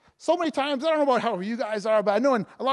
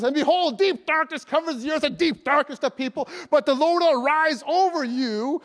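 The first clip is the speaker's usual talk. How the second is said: higher, louder ringing verse reading; scripture proclamation; 6 semitones higher, 4 dB louder than usual. Real, not acted. Preacher reading verse two to congregation, bold proclamation. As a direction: declare it boldly, slowing on the last phrase.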